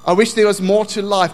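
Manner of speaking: loud inner longing. Acted. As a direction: voice the complaint forcefully. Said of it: "is such a loud voice".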